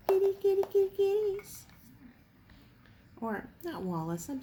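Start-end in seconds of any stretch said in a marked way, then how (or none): 0.1-1.6 high, loud sing-song kitty call; high loud cat call. Real, not acted. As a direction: call the cats high and bright. Calling cats.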